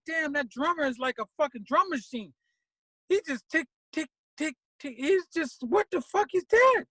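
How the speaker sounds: high, animated praise with tick sounds; excited rave. Retold or imitated. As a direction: gush about the drummer. Raving about the drummer.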